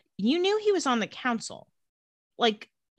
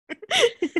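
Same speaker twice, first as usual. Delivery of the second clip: fake cough.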